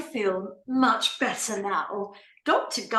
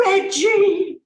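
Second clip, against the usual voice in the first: loud, higher cry of recognition; joyful surprise; 7 semitones higher, 7 dB louder than usual. Acted. Wife spotting her husband, surprised loud cry. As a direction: burst out his name in surprise.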